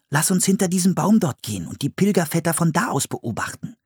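put-on high child's voice, earnest; planning child character. A narrator's reading. Boy suggesting a hiding place to his sister, high and earnest. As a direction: pitch it up like a boy proposing a plan.

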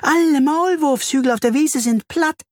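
very high, urgent little voice; excited high-pitched report; excited bee. A narrator's reading. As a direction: break alarming news in a high pitch. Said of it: "summt sie aufgeregt".

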